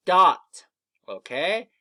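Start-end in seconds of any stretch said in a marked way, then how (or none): 0.1-0.7 "dot" as one short, loud word; said fast. Shown as a demonstration. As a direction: punch out "dot" without splitting it. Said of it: "when we say it fast".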